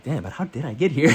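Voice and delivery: laughing through the words. Retold or imitated. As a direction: chuckle it out, amused. Laughing at own confusion.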